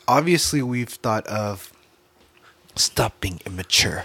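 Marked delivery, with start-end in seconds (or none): 2.8-4.0 hushed scolding whisper. Real, not acted. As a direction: whisper a quick telling-off.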